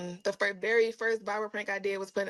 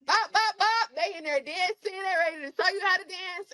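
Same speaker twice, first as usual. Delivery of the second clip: excited; 9 semitones higher than usual. Retold or imitated. Imitating Jamaican party energy.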